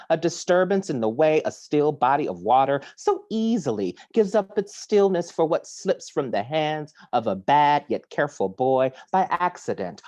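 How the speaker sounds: usual speaking voice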